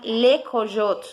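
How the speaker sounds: le coyote said slowly with French vowels; French pronunciation. Shown as a demonstration. Teacher modelling le coyote for the class, slow. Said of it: "lo vamos a pronunciar".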